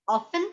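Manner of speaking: Often as OFF-en, no t, rising; Often as OFF-en with a rise; silent t. Shown as a demonstration. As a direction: say Often without the t, tune rising. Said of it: "Americans often बोलते हैं".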